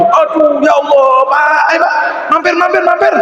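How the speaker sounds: high, shouted eager invitation; eager welcome. Acted. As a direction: call him in, almost begging. Ibrahim eagerly invites Majusi in.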